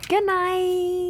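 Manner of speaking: high sing-song goodnight; high playful goodnight. Acted. Wife saying goodnight to listeners, high sing-song. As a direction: chirp it out.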